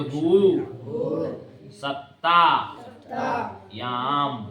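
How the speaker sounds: "भू सत्ता याम" very slow, broken into separate pieces; word-by-word dictation. Shown as a demonstration. Teacher has class repeat after him, "भू सत्ता याम" slowly piece by piece. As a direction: draw out "भू", "सत्ता", "याम" with pauses between. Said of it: "बोलो".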